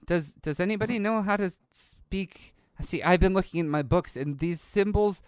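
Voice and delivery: put-on curious young bookworm; young boy character. Game master plays young boy.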